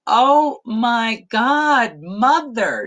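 slow, laughing groan of disbelief; a mother laughing at her own mother; laughing exasperation. Retold or imitated. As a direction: drag it out, amused and embarrassed. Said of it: "she's like".

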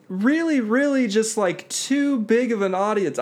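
high put-on presenter voice; mimicked stage presenter. Imitating the nervous woman on stage, high overdone voice. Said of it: "she talks like this".

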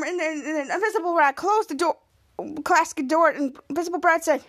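ordinary delivery

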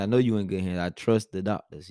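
normal talking voice

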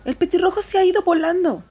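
high, loud, disappointed cry; Lucy wails that the bird is gone; dismayed. A narrator's reading. Lucy upset the robin left. (queja alta y fuerte, decepcionada)

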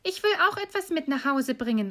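put-on high little-girl voice; eager little Gretel. Gretel filling her apron with gems, eager high voice. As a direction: chime in eagerly, pitched high. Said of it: "und Gretel sagte".

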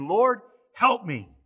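loud, drawn-out plea, imitating her; slow, emphatic retold cry for help; retold exclaimed plea. Preacher voices the kneeling woman before Jesus, exclaimed slowly and loudly. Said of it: "and exclaimed".

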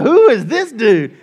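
puzzled onlookers. Retold or imitated. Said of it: "they're looking at me like".

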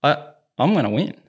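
lightly voiced brash teen boasting; imitating Nick. Coach quoting the player's winning mentality, lightly cocky. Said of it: "He's like".

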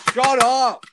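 sudden loud, high shout; yelled interruption. Real, not acted. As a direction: yell to silence your co-host mid-plug. Snapping at co-host during plug.

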